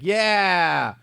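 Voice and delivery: high, drawn-out mock whoop; imitated heckler. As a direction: whoop like a rowdy audience member. Imitating a rowdy audience heckler.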